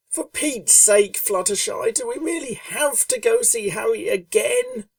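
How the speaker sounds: irritable Rainbow Dash. A narrator's reading. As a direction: grumble it up high like a restless young pegasus. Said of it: "asked Rainbow Dash irritably".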